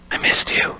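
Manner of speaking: breathy unvoiced whisper of I missed you; whispered confession. [name] whispering to Brandon nose to nose, softly. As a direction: hush it right into his face.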